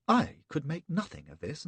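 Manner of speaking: ordinary delivery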